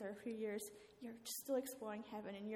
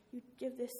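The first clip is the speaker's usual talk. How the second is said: usual speaking voice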